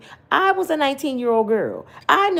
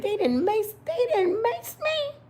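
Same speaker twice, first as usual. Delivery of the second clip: squealing in disbelief, much higher; mock high shriek; 12 semitones higher, slower than usual. Grandma squealing at being maced. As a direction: retell it in a high-pitched squeal of shock. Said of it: "I said".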